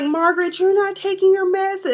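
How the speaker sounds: high-pitched mock nurse reprimand; scolding nurse. Nurse scolding an old patient.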